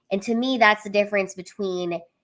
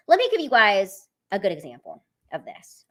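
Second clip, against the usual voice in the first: usual speaking voice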